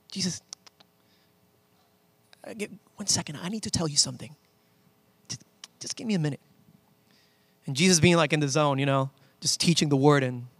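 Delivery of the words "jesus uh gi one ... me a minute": hushed interrupting Martha. Acted. Martha cutting in while Jesus teaches, hushed and insistent. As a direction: try to get his attention under your breath. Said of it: "here comes Martha, being like".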